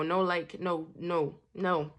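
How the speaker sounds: normal talking voice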